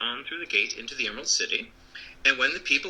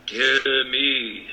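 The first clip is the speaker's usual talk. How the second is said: wizard voice. A narrator's reading. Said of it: "said the voice".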